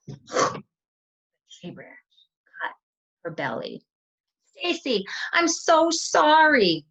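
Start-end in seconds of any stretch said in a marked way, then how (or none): 4.4-6.9 high loud slow pleading sorry; loud high tearful sorry; tearful apology. Retold or imitated. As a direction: beg forgiveness, half crying. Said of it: "I said".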